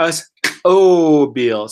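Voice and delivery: "Us Chobil" said very slowly and clearly; slow demo. Shown as a demonstration. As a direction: stretch out "Us Chobil" so the learner can copy it. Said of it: "Let's try it one more time".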